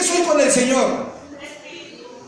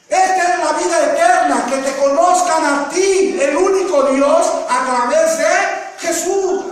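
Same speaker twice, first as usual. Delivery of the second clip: full-force preaching; 2 semitones higher than usual. Acted. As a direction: proclaim the verse to the church. Predica a toda fuerza. (predicación fuerte y elevada)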